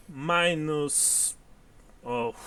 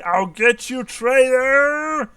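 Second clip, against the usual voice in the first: high, loud battle cry at a traitor; screaming; 11 semitones higher, 9 dB louder, slower than usual. Acted. Game master voices a vampire hunter threatening a traitor, screaming. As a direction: yell it out, stretching the last word high. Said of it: "Lorinok screaming".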